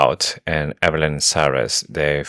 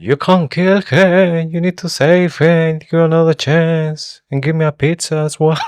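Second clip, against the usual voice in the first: high, pleading mock prophet; hammed-up dramatic plea; mock oracle voice; 13 semitones higher, 5 dB louder than usual.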